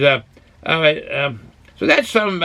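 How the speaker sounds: ordinary talk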